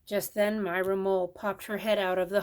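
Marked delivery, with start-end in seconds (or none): none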